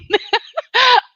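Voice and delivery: high, loud helpless laugh; sudden high-pitched cracking-up; real burst of laughter. Real, not acted. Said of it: "those make me laugh".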